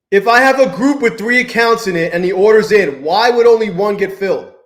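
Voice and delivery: fed-up outburst at the screen; frustrated rant. Real, not acted. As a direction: vent at the glitching software. Ranting at broken trading software.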